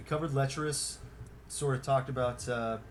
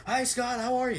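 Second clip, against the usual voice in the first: much higher, chirpy greeting; eager fan voice; 12 semitones higher, 4 dB louder than usual. Retold or imitated. Fan greeting Scott in line, high eager voice. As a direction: greet him like an overeager fan.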